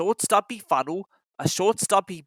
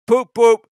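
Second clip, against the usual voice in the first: engine hoot; 5 semitones higher, 10 dB louder than usual. Acted. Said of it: "said Gordon".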